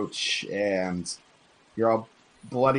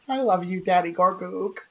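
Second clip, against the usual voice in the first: put-on little boy voice; 8 semitones higher than usual. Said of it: "and he says".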